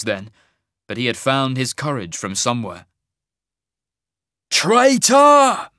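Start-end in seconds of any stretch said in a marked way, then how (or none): 4.5-5.7 high, loud accusing shout; enraged scream. A narrator's reading. Harbour master charges at half-mage, screaming. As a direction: scream the word at the man you hate. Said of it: "Lashen screamed, spittle spraying from his mouth".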